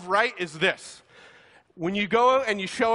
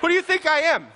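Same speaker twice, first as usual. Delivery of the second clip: higher and louder, fed up; a loud, high, incredulous who do you think I am; exasperated outburst; 7 semitones higher, 4 dB louder than usual. Acted. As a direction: throw the question back at him in disbelief. Comic exasperation at athlete.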